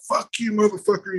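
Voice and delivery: high-pitched mock fury; going crazy. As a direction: act out a wild insult without shouting. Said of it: "we're just going crazy".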